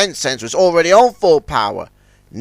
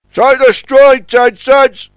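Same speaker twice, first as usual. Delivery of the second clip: loud, high call; 10 semitones higher, 8 dB louder, slower than usual. A narrator's reading. The crane calls to Ten Cents during the pull, loud. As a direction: shout the order across the dock. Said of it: "called Scuttlebutt".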